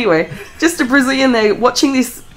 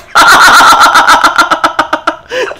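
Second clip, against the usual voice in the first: long, high, loud burst of laughter; hearty laugh; 7 semitones higher, 6 dB louder than usual. Real, not acted. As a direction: crack up helplessly at the joke. Both joking about tortilla presses.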